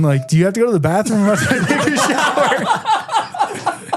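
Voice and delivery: words breaking up into high laughter; laughing. Real, not acted. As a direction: crack up while finishing the quote. Cracks up retelling the door prank.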